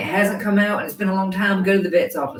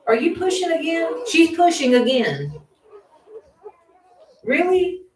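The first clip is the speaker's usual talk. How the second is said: surprised; 7 semitones higher than usual. Real, not acted. Surprised that another puppy is coming.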